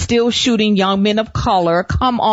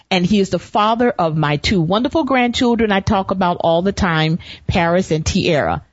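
ordinary talk, nothing marked

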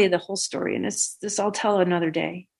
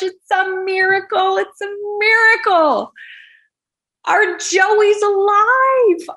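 much higher, slow, drawn-out cry of joy; overjoyed family; 13 semitones higher, 7 dB louder, much slower than usual. Retold or imitated. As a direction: stretch each word in tearful wonder. Joe's family greets Lisa, overjoyed.

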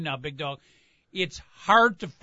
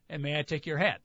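polite doorman; 4 semitones lower, 5 dB quieter than usual. Retold or imitated. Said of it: "fancy hotel".

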